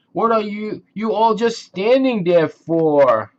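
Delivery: muffled, trapped cries. Acted. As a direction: call out muffled from inside. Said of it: "Moose muffled his voice cried".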